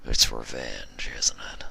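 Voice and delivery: low, slow hushed mumble; quiet old stallion mumbling; mumbled quietly. A narrator's reading. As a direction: let the guess slip out softly. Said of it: "the stallion mumbled quietly".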